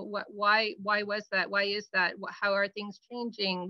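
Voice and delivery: ordinary talk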